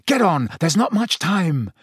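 Harry urging Sirius onto the hippogriff, urgent. Acted. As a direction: press him to hurry. Harry's voiced lines.

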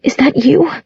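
high, tentative question; high and hesitant; choked. A narrator's reading. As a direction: ask it, a touch choked up. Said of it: "her voice choked".

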